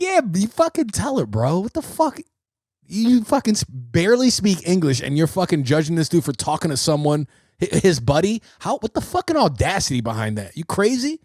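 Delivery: high-pitched, incredulous retold outburst; worked-up egging on. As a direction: cheer him on, voice jumping up in disbelief.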